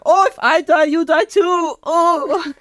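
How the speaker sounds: high-pitched hammed-up wail; falling German villain. German man dragging Michael toward the volcano, high melodramatic wail. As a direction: wail the threat high, mock desperate. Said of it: "pulling him down in with him".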